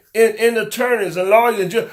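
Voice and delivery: ordinary delivery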